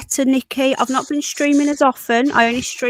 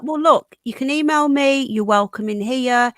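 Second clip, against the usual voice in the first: ordinary delivery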